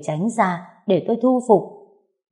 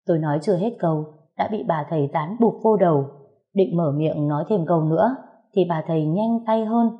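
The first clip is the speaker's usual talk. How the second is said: usual speaking voice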